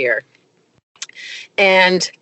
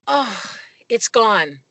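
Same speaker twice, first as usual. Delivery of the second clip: relieved sigh; 3 semitones higher, slower than usual. Retold or imitated. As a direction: breathe in, then slowly sigh it out. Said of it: "sighs a sigh of relief".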